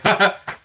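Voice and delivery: high put-on ha-ha; mimicked taunting laugh. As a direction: imitate his winner's laugh. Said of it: "he was just like".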